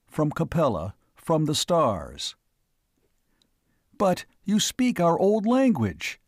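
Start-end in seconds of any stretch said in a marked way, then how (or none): 4.0-6.2 higher, amazed outburst; can't believe his ears; a cry of disbelief; incredulous cry. A narrator's reading. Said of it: "he cried incredulously".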